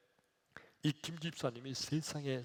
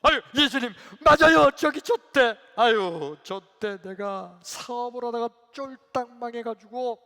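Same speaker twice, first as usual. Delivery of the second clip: high, loud acted outcry; worked-up lament; 9 semitones higher, 16 dB louder than usual. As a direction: cry out to Jesus in remembered hardship. Acted deacon reliving business failure. (크고 높게 하소연하듯)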